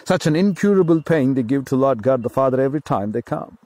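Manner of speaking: normal talking voice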